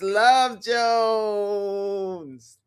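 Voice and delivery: Love Jones stretched out slowly, swooning; gushing. Real, not acted. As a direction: savour "Love Jones" like a dear memory. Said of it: "Oh, y'all, yes".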